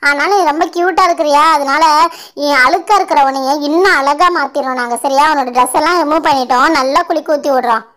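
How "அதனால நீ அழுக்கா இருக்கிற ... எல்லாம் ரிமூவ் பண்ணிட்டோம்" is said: ordinary talk, nothing marked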